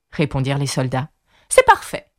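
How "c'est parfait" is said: very high, loud queenly squawk; bawling. A narrator's reading. Queen approves to her soldiers: high bawl. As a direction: bawl it out at the top of the range. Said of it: "brailla la reine".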